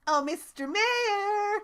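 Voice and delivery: high slow Percy voice. Retold or imitated. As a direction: greet the mayor sweetly. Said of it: "do the Percy voice".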